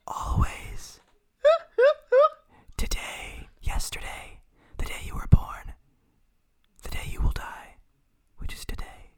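ominous whispered pronouncements, spaced out; creepy whisper. Pretend. Co-host foretelling the listener's death, ominous whisper. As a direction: whisper it like a mock threat. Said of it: "if I speak like this".

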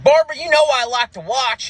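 high, pushed husband voice; husband catching the comic in bed, a high barked challenge; angry husband. Retold or imitated. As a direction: bark it like a caught-out husband with a shotgun. Husband voice continues.

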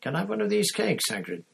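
put-on high, fast boy's voice; boy cheekily asking for cake; Ron. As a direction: chirp it out casually.

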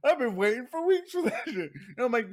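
high, laughing retelling. Real, not acted. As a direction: tell it through giggles.

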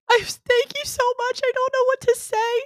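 high, choked-up pretend gratitude; mock teary thanks. Imitating her friend after secretly crying, high teary thanks. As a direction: gush thanks high as if fighting tears. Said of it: "You're like".

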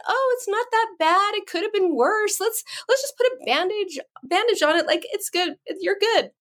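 high, sing-song brush-off; mock-chirpy minimizing of a child's hurt; dismissive cheer. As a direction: imitate a parent making light of it. Mother brushing off child's pain.

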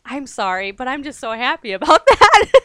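apology giving way to laughing delight; imitated happy confession. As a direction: act out barely contained happiness. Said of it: "she got so excited".

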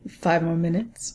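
half-asleep man stalling in bed; sleepy grumble. Retold or imitated. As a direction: drag it out like someone refusing to get up. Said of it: "even then he's like".

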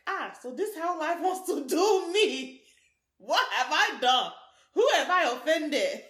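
slow, loud, dramatic wailing complaint; a personified bad year wailing at fate; mock lament. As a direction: ham it up like a wronged soul. Year 2018 voiced lamenting.

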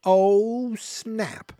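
long, high, drawn-out "oh", loud at first; audience exclamation. Acted. 1978 audience reacting to the movie's tease, exclaiming in shock. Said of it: "They went".

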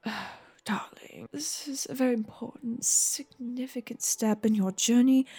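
hushed, whispery parental counsel; tender, solemn father. Acted. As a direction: whisper gravely and lovingly to your child. Game master plays solemn father.

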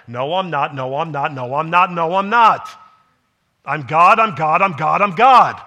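building from firm to a full shout, pitch rising; emphatic denial building. Acted. Jesus answering the accusers, rising to a shout.

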